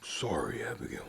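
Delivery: soft unvoiced whisper; hushed, sorry whisper; whispered apology. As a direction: breathe the apology out gently. Sheriff apologizes to grieving widow.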